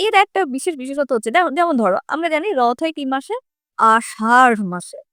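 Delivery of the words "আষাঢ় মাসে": আষাঢ় said slowly with a flapped ঢ়; slow careful demo. Shown as a demonstration. Teacher modelling আষাঢ় for the class, slow and careful. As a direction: draw out আষাঢ় with the flap ড়. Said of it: "আমি কি উচ্চারণ করলাম".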